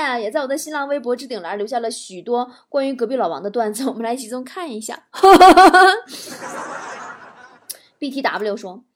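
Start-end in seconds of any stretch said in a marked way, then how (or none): 5.1-7.4 loud, high burst of laughter; long, high cackling laugh; gleeful cackle. Acted. Host cackles before the Lao Wang jokes, gleefully.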